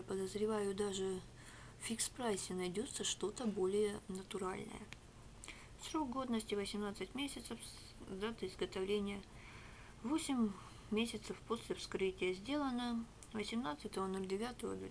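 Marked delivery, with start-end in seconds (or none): none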